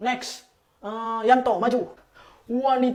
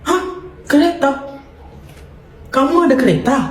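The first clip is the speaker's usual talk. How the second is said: surprised; 5 semitones higher than usual. Acted. Shocked that classmates own motorbikes.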